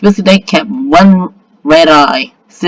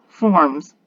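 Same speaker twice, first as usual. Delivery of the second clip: ordinary delivery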